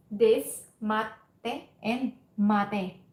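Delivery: "mate" plain, then "mate" with a held tsu pause; very slow, "mate" then "mate" with a catch before te; small tsu contrast. Shown as a demonstration. Teacher contrasts mate and matte for students, slow and exaggerated. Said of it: "the small tsu sound".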